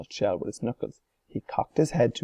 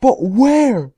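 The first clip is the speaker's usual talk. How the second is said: high, loud frantic shriek; 13 semitones higher, 12 dB louder than usual. A narrator's reading. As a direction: shriek in horror. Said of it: "shrieked Missus Salt".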